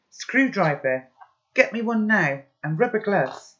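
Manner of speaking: loud, commanding demands; urgent orders. Acted. Pi ordering Macca for tools, loud and commanding. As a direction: snap out orders in an emergency.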